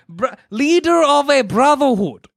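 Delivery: mocking announcement. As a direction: boom out the ridiculous job title. Mocking Magneto's job on census form.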